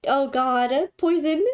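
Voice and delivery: ordinary delivery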